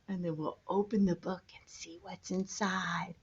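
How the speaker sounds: soft whispered build-up. Acted. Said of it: "whisper heard".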